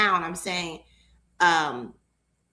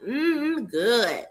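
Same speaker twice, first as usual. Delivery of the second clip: savoring mm good; 4 semitones higher than usual. Shown as a demonstration. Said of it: "that's how I like to say it".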